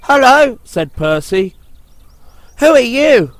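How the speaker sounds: high, loud friendly engine; cheery little engine. A narrator's reading. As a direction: call up curiously to the stranger. Percy greets helicopter.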